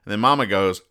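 ordinary talk, nothing marked

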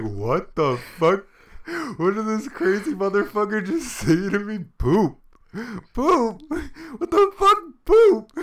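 building from a loud confused snap to a high, very loud outraged yell; mock angry confusion. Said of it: "He's gonna look at you and be like".

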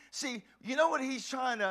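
normal talking voice

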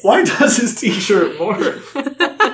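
laughing. Real, not acted. Laughing question.

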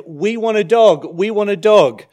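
mock kids begging for a dog; imitating the kids. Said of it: "our children have said".

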